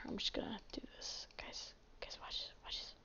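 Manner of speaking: hushed, voiceless whisper; secretive whisper. Pretend. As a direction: whisper it conspiratorially to the viewers. Secretive aside to viewers.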